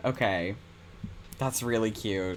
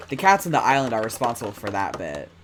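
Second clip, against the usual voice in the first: normal talking voice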